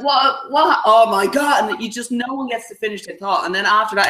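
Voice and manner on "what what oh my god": shocked. Retold or imitated. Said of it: "you're like".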